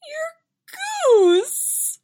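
very high, emotional mom squeal; overjoyed, near tears. A narrator's reading. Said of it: "ready to cry with joy".

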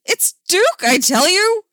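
high, loud insistence; frantic accusation. Acted. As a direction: insist on the culprit melodramatically. Hammy accusation in the game.

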